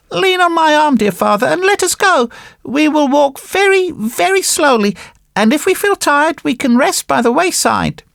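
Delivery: high-pitched puppet voice, encouraging; caring puppet boy. Acted. Puppet boy helps his weak father along, high and caring. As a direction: support your father in a high little voice.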